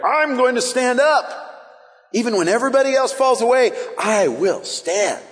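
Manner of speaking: high, ringing vow of loyalty; bold friend Eric. Acted. Peter vowing to stand when others fall, boldly. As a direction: declare it like a zealot, stretching the final words. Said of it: "this is my friend Eric".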